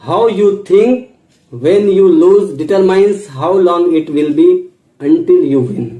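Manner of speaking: each word spaced out slowly; slow, deliberate quote. Shown as a demonstration. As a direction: read the motto slowly, letting it land. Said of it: "English quote read slowly". (धीरे-धीरे, ठहराव के साथ उद्धरण)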